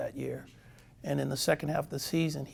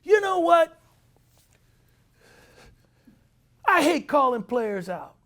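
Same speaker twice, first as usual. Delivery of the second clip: high, loud exasperated manager; high, angry manager voice; 11 semitones higher, 8 dB louder than usual. Retold or imitated. Manager berating his players over the book, high and loud. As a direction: demand it in a raised, exasperated voice. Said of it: "So he says".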